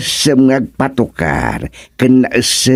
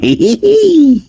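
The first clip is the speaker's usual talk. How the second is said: a shrieking little laugh; high squeal of laughter. Acted. Friend laughing at mention of Banong, squealing laugh. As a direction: let the laugh jump way up.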